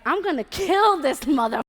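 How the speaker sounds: loud, high, acted-out rage; furious outburst. Her reacting to being smacked in the face, furious outburst. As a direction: replay exploding in fury at an attacker. Retold fury after being slapped.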